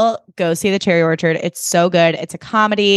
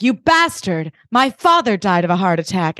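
imitating Glenn Close. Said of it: "And she's like".

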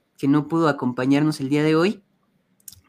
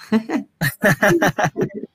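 rising giggle; light laugh; 5 semitones higher than usual. Real, not acted. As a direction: laugh at the shared joke. Laughing at own joke.

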